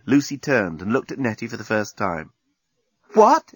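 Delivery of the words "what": high, loud startled what; shocked she blurts it out; exclaiming. Acted. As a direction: friend stunned by Lucy's confession, exclaiming. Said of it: "exclaimed Nettie".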